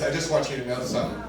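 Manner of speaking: softly spoken, offhand confession; casual confiding. Retold or imitated. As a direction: casually let a friend in on it. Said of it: "casually, in conversation, he says".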